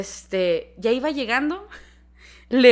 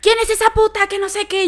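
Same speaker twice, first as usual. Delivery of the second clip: high, loud imitated yelling; background screaming; 11 semitones higher, 5 dB louder than usual. Jealous woman yelling outside during the call, imitated screaming. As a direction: mimic an enraged shriek from the background. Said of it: "y se escucha en el fondo de".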